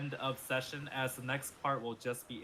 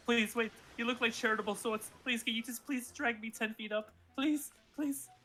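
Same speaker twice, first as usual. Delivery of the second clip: high whiny begging; whimpering plea; 8 semitones higher than usual. Acted. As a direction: whimper and plead. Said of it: "I listen to this whimper".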